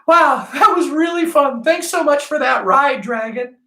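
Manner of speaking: mock a delighted tiger to be absurd; silly tiger. Teacher joking what the tiger might say, silly high voice. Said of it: "the tiger was gonna stop and go".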